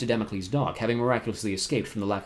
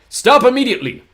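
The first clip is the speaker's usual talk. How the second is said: much higher, louder command; ordering; 14 semitones higher, 13 dB louder than usual. A narrator's reading. Poe orders Olaf through the gate, commanding. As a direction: bark the order. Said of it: "Mister Poe ordered".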